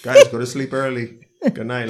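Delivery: loud bedtime-parent call. Pretend. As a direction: tell the kids to go to bed. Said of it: "I feel like I'm talking to the kids".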